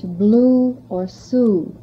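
"blue or sue" drawn out slowly on the long oo vowel; slow vowel demo. Shown as a demonstration. Teacher slowly modelling the oo vowel for the class. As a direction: stretch the oo in "blue or sue" for learners. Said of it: "pronounced as in English".

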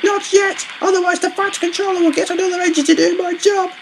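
very high-pitched worried plea; high, anxious character voice; pleading little engine. A narrator's reading. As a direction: beg as a small engine afraid of losing work. Stanley pleads with driver.

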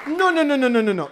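loud and high frantic denial; shouted no. Retold or imitated. (ella grita que no, muy fuerte y aguda)

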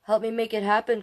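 plain reading voice

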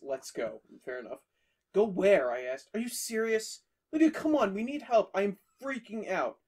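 usual speaking voice

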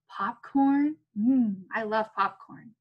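usual speaking voice

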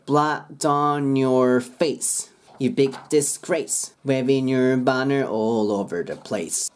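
slow "on your" as "on-nyor"; linked N and Y. Shown as a demonstration. Teacher modelling the lyrics, slow and linked. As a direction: stretch "Waving your banner" with the words joined. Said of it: "NとYがくっついて".

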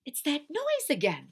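high-pitched alarm; Missus Watson. Acted. Alarmed wife hears the cry.

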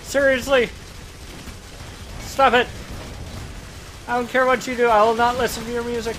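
loud, high protest at the enemy; mock-indignant shouting. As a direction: yell at your opponent to stop. Scolds game enemy while fighting.

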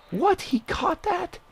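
very high, stunned questions; can't believe that catch; incredulous squeal at the screen; shocked disbelief. Real, not acted. Unexpected catch against.

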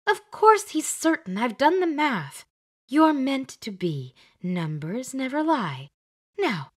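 indignant Glenda. Acted. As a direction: put her in her place, she did the math. Glenda scolds Beth.

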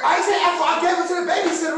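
husband defending himself to his wife; defensive protest. Acted. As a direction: protest fast and loud. Defending prank in argument.

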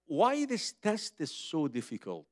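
ordinary delivery

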